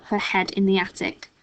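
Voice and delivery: ordinary delivery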